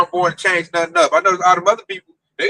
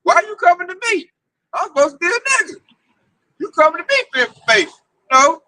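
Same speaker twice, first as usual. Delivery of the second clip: mocking retort; 9 semitones higher than usual. Telling the Meccas he is no news source, in a high mocking voice. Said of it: "I'm like".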